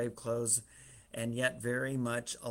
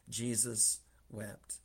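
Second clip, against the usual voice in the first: solemn verse; 2 semitones lower, 4 dB quieter, much slower than usual. A narrator's reading. Preacher reads the shortest verse, slowly and solemnly. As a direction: give each word weight, unhurried. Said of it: "one of the most powerful verses".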